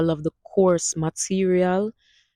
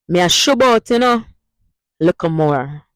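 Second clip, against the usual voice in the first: lively Patois goodbye; 6 dB louder than usual. Shown as a demonstration. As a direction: say bye like a Jamaican heading out. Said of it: "Use it in a sentence now".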